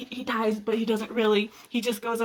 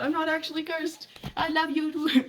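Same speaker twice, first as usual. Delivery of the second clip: higher-pitched retold love confession; excited declaration; 6 semitones higher than usual. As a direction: blurt it out high, imitating the lovestruck hero. Retelling a hero's excited declaration.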